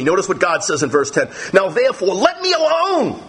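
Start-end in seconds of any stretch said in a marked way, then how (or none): 1.5-3.2 high, heated voice of an angry God; furious divine warning, pitched high. Acted. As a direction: let God's fury burn through the words. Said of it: "Notice God is so angry".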